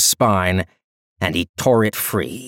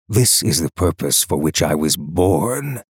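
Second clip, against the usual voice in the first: cold, lower emperor voice; cold rasping evil emperor; 4 semitones lower than usual. Acted. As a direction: speak down with icy disdain. The Emperor speaking to captors.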